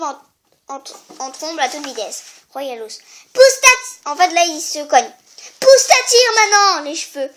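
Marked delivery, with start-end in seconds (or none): 3.3-4.1 loud high yell at a driver; road rage. Acted. Oedipus yelling at the king's chariot, furious. 5.6-6.8 loud high yell at a driver; road rage. Acted. Oedipus yelling at the king's chariot, furious.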